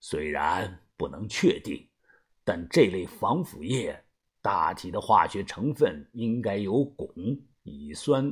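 a frowning veteran weighing his words; deep, quiet and troubled leader; worried old boss. A narrator's reading. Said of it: "把头皱着眉说".

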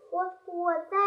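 plain reading voice

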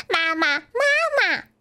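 put-on high-pitched baby duckling calling for its mother; 小鸭子叫喊. A narrator's reading. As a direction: cry out "mama" in a high little voice. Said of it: "叫着". (小鸭子尖声叫妈妈)

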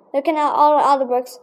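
plain reading voice

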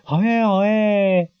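high, slow, delighted cheer; queen claps and cheers excitedly; excited clapping. Acted. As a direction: cheer like a spoiled child clapping. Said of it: "兴奋地拍手说".

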